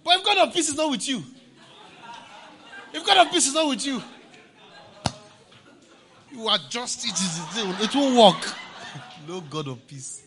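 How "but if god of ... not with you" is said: higher, louder preaching warning. Real, not acted. Preacher warning the congregation without peace, loud. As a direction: drive it home to the pews.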